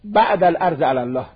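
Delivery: scripture-reading voice, unhurried; slow hadith recitation. Acted. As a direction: intone the Arabic slowly and evenly. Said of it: "حدیث داریم".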